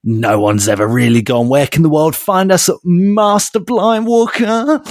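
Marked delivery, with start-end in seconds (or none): 1.5-4.8 lightly voiced mock announcer; impression. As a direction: stretch out Walker with a rising swoop. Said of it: "let me do an impression".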